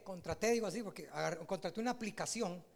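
normal talking voice

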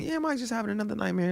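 high, quick, hushed mom voice; a mother quietly explaining on the phone. Retold or imitated. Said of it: "and then she'd be on the phone".

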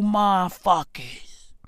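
usual speaking voice

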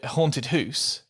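"hoose" with a long "oo"; house like moose. Shown as a demonstration. Host laughing into a Scottish-sounding "hoose". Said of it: "house like moose".

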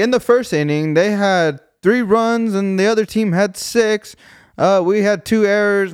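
lightly hammed-up play-by-play breakdown; mock sports announcer. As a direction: recite the inning like a put-on announcer. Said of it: "I'm not some fucking professional announcer".

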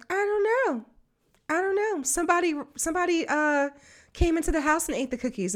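high, small little-girl voice, lightly played; imitating a toddler. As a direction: mimic a three-year-old fibbing. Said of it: "the little girl was like".

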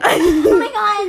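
mocking friend's voice. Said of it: "You sound like such".

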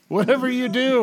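high, loud mock warning call; shouted warning. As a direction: chime in with a high yell.

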